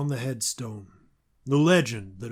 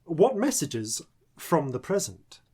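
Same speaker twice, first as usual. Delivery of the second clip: normal talking voice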